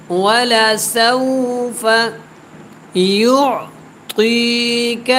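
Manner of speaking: slow, melodic tilawat, each word drawn out; measured, sung-out Quranic recitation. Shown as a demonstration. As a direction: chant the verse slowly and melodically.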